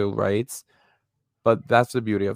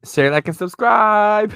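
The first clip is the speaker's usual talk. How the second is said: excited sign-off; 12 semitones higher, 9 dB louder, slower than usual. Acted. Cheerful outro sign-off.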